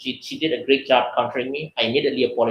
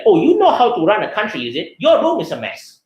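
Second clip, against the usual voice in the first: mock scolding parent; 7 semitones higher, 4 dB louder than usual. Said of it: "the parent is like".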